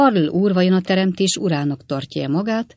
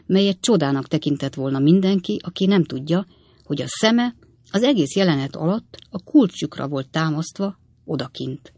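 ordinary delivery

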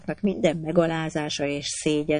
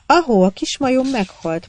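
put-on child prince; 8 semitones higher, 5 dB louder than usual.

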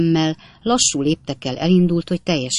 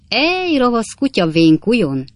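higher, louder teasing through laughter; laughing teasing; 7 semitones higher, 5 dB louder than usual. A narrator's reading. Wardle teasing Pickwick as a sly dog, laughing. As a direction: rib him with a laugh. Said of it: "És úgy nevetett".

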